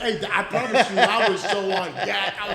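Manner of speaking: loud, high, laughing through the words; real laughter carrying the story. Real, not acted. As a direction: talk while cracking up. Laughing while telling story.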